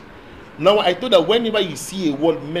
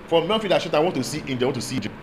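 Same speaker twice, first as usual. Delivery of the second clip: usual speaking voice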